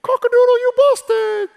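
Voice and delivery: high, loud crowing rooster voice. Retold or imitated. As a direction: crow it out like a gotcha. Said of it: "the rooster said".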